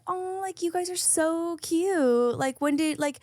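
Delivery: high, syrupy fake-nice; sugary passive-aggressive gushing; mock sweet voice. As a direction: gush over them with fake sweetness. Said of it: "and I was like".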